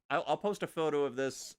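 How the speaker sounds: usual speaking voice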